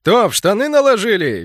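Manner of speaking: loud, high jeer; cheerful shout. A narrator's reading. Enemy gnome jeering at the halted squad, cheerful shout. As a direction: call out merrily, taunting. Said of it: "весело крикнул один из быков".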